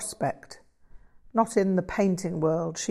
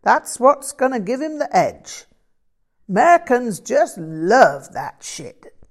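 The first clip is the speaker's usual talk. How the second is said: loud American blues character voice; musing that builds into emphatic American slang delivery; enthusiastic American bluesman; 4 semitones higher, 8 dB louder than usual. Acted. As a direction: pitch it up like an excited bluesman.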